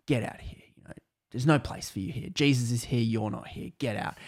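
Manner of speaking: hushed, firm casting out; whispered command. Retold or imitated. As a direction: whisper the order to the demon. Commanding a demon to leave.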